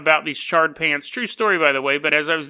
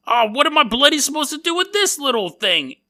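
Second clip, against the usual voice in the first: put-on higher, put-upon Cockney whine of a boy; indignant mock British; 8 semitones higher than usual. Schoolboy offered tiny cheese, higher mock-British outrage.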